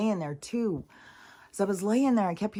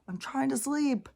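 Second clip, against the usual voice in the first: much higher, strained mock scolding; telling her thoughts off; 9 semitones higher than usual. She tells her own racing thoughts to shut up, high mock scolding. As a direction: snap at the chattering mind to let her sleep. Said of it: "I was like".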